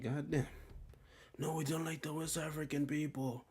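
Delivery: mocking a dismissive stranger; mock disdainful voice. Mocking East Africans' disdain.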